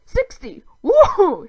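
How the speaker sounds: high, loud triumphant whoop; gleeful dwarf celebration; gleeful cry. A narrator's reading. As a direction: cheer like you won a game. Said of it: "he cried".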